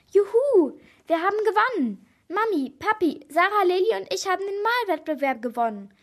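high, excited celebration; jubilant cheer. A narrator's reading. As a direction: cheer the news out to everyone. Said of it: "jubelte ich". (jubelnd, begeistert)

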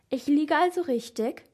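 ordinary delivery

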